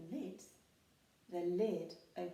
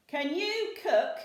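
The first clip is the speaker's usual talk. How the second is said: higher, loud, slow hopeful question; eager pirate; 8 semitones higher, 14 dB louder than usual. A narrator's reading. Handkerchief asks troll about cooking, eager. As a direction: ask with sudden interest. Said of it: "he asked".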